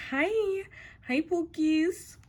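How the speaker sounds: normal talking voice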